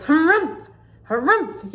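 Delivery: loud, high huffy harumph; indignant bird harumph. Acted. Bonga bird yelling at the squirrels, loud high harumph. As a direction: snort it out like an outraged bird. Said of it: "yelled a bonga bird".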